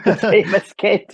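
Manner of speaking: laughing through the words; light amused chuckle. Real, not acted. Echoing the host, laughing.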